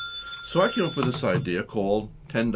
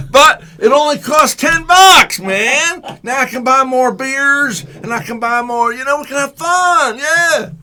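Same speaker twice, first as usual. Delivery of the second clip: high, loud, stretched-out cheering; excited fan shout; 12 semitones higher, 10 dB louder, slower than usual. Acted. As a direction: holler like a thrilled beer-drinking concertgoer. Acts thrilled cheap-ticket fan.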